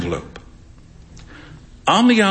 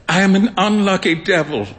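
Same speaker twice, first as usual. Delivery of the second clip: usual speaking voice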